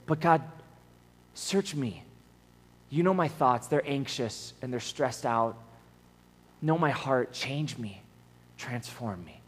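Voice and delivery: soft, fading to a hush; a surrendered inner prayer; humble plea. Retold or imitated. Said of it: "at the end, he goes".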